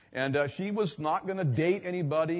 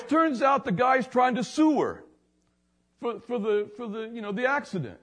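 much higher, astonished retold voice; amazed man; 9 semitones higher, 4 dB louder than usual. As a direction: marvel out loud in disbelief. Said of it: "And he goes".

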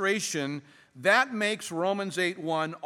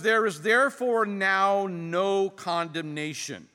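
slow, deliberate scripture reading; careful reading; 3 semitones higher, 4 dB louder, slower than usual. Real, not acted. Preacher reading the verse to the congregation, slow and careful. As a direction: read it out weightily, drawing out the words. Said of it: "let's read these verses together very carefully".

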